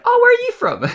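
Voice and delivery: high-pitched, loud imitation of curious locals; retold puzzled question. Retelling how native Australians question the speaker, mock-puzzled. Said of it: "everybody who's native here in Australia is like".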